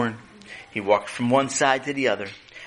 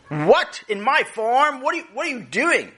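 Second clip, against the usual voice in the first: outraged farmer; 12 semitones higher than usual. Acted. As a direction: sputter at the trespasser in a high voice.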